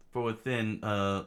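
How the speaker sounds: ordinary delivery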